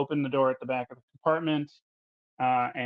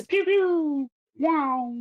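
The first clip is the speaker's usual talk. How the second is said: high-pitched "pew pew" and ricochet noise; imitated gunfire; 14 semitones higher, 5 dB louder, much slower than usual. Game master voicing a missed shot, imitated gunfire. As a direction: make high, loud toy-like shooting noises.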